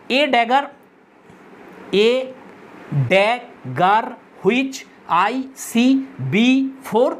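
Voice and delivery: "a dagger which I see before" split syllable by syllable with long pauses; very slow, each beat of "a dagger which I see before" set apart; syllable-by-syllable scansion. Shown as a demonstration. Teacher counting out syllables for students, "a dagger which I see before" broken apart. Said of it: "এই লাইনটা যদি আমরা scan সান করি".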